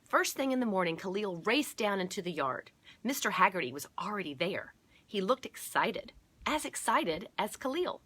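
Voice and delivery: bouncy picture-book reading, punching key words; story narration. A narrator's reading. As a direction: read the story out with building excitement. Reads the story aloud.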